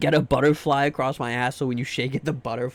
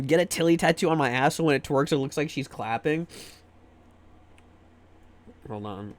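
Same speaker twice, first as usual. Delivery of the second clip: normal talking voice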